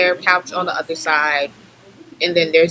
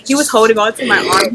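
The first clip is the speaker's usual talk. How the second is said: normal talking voice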